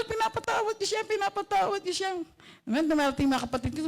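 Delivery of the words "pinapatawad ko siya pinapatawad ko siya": high, earnest repeated vow. Retold or imitated. Preacher retells telling herself she forgives, high and earnest. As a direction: insist on forgiving, pitched up high. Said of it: "Sabi ko".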